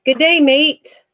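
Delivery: lightly hammed-up Australian accent; mock Australian accent. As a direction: have a go at an Australian greeting. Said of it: "what do you say to Australia again".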